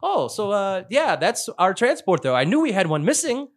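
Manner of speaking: high-pitched puzzled crewman voice; imagined Scottish crewman. Acted. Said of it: "Just imagine the Scottish".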